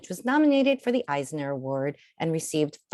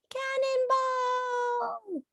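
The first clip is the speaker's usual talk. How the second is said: very high, drawn-out, slow joyful yell; gleeful cannonball cry; 3 dB quieter, much slower than usual. A narrator's reading. Storyteller voicing the child's gleeful jump. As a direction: stretch it like a kid flying into a pool.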